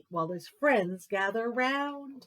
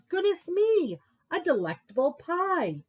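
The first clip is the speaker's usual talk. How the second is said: a high, louder bear voice; Bear's delight; 8 semitones higher, 4 dB louder than usual. Acted. Bear admiring the pie, high delighted voice. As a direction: gush over the pie up high.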